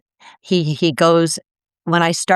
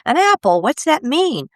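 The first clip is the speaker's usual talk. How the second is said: eager insistence; 10 semitones higher than usual. Retold or imitated. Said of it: "He says".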